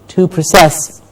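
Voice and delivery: process as "pruh-SESS"; verb stress. Shown as a demonstration. Teacher pointing out the verb, back-stressed proCESS. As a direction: stress the second syllable of process. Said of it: "that's the verb".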